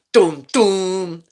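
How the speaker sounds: low growly rapper ad-lib; mock gruff rapper growl. Shown as a demonstration. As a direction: growl out a low rap hook. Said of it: "That's my impression of D M X".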